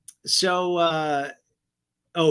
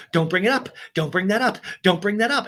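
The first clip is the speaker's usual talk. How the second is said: agitated pleading; 6 semitones higher, 4 dB louder than usual. Retold or imitated.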